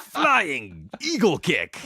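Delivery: drawn-out shouted special-move call; Blue. Shown as a demonstration. Voice actor demonstrating his game character's kick, shouted battle cry. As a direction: yell it high and slow like an arcade fighter. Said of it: "the voice of the character Blue".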